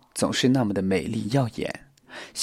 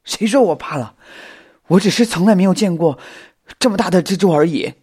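high, loud, defensive protest; tongue-tied bravado; 8 semitones higher, 7 dB louder than usual. A narrator's reading. Xiao Xin denying being afraid, tongue-tied bravado. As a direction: protest too much, nervously. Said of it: "小新的舌头仿佛有些打结".

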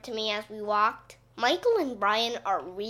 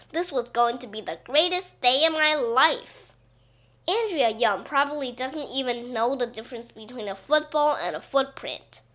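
usual speaking voice

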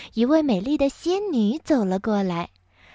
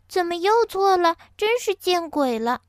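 high-voiced fairy, exasperated; 仙女懊恼; 8 semitones higher than usual. A narrator's reading. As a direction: fuss over a botched spell in a lifted voice. 仙女为又变错而懊恼. (提高嗓音，懊恼抱怨)

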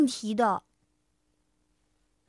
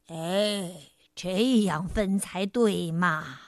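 low, slow, smug tiger; big tiger voice; 9 semitones lower, slower than usual. A narrator's reading. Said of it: "大老虎说". (低沉缓慢的大老虎腔)

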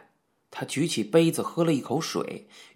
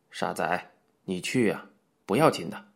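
soothing; 6 semitones lower than usual. A narrator's reading. Coach Yang coaxes A-Xiong, soothing. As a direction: gently reassure a frightened simpleton. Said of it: "杨教头安抚他道".

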